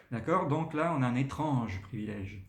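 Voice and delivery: usual speaking voice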